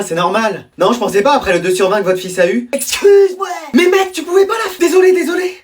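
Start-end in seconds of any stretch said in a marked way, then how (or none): 2.7-5.6 angry shout. Acted. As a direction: blow up at the friend who snitched. Son shouts at tattling friend.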